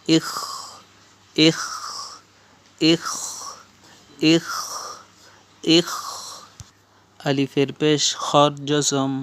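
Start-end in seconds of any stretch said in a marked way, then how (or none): 0.1-5.9 "ikh" repeated singly, with pauses; drilled "ikh". Shown as a demonstration. Teacher shows learners the jazm sound, drilling "ikh". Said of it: "উচ্চারণ".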